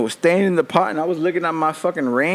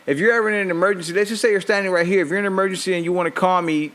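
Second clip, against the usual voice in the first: fast lecture; 4 semitones higher, faster than usual. Retold or imitated. Lecturing wife on directions.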